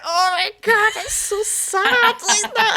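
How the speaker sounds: high, loud hammed-up wail; mock crying. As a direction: wail it as if bursting into tears. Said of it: "I burst out crying".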